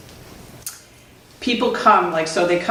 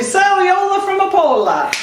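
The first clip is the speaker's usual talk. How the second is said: hearty greeting call; 12 semitones higher than usual. Retold or imitated. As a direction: sing out the name in a high, stretched greeting. Re-enacts addressing student by name.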